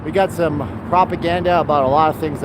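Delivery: ordinary talk, nothing marked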